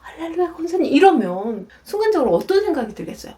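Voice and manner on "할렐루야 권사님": softer, high-pitched polite greeting; lightly imitated phone politeness; sweet phone greeting. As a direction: quickly echo mother's churchy phone voice. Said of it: "막 소리 지르다가 막".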